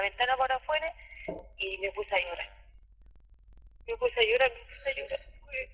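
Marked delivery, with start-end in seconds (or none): 3.9-5.7 real tears rising, words almost lost; tearful. Real, not acted. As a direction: voice breaks higher, then fades into near-whisper. Recounting the assault, crying.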